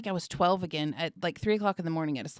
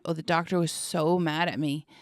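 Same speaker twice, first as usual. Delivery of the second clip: normal talking voice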